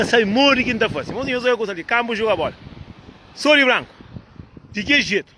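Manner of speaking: ordinary talk, nothing marked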